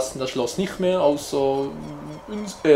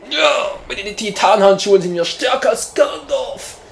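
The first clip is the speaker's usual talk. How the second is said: triumphant shout; 5 semitones higher, 5 dB louder than usual. Real, not acted.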